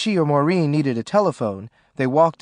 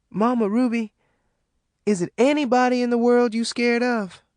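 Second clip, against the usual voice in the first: put-on young girl, higher and hesitant; young daughter, higher and tentative; Maureen voice; 10 semitones higher, 3 dB louder than usual. A narrator's reading. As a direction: ask her mama carefully, higher voice. Maureen asks timidly.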